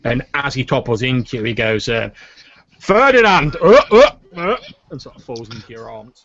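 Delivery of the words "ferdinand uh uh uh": high, loud cry with grunts; drunken exclamation. Acted. Drunk man toppling into someone's arms, loud drunken cry. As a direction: call out loudly, then grunt while toppling.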